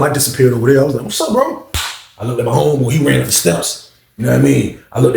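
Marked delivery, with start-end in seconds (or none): 1.0-1.7 pitched-up mock hello; squeaky imitated greeting; high greeting. Greeting the homeboy.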